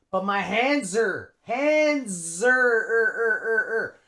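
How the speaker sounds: "hands are" as "handzer"; contraction. Shown as a demonstration. Teacher drills the contraction, reduced and repeated. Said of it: "We use the contraction".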